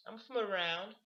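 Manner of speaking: mock a bored girl dodging the question; dismissive girl. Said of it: "she says some shit like".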